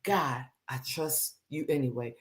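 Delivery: soft, hushed words to God; whisper-close trust in God; hushed prayer. Retold or imitated. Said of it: "And I still say".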